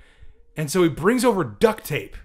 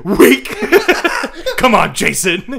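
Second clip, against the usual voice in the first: laughing mock; 4 semitones higher, 7 dB louder than usual. Real, not acted. Friend mocks classmate giving in.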